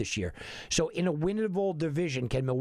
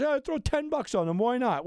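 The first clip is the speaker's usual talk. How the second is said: mock careless bettor; 10 semitones higher than usual. Host mimicking the guy who throws money away, lightly mocking. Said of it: "I hate being the guy that goes".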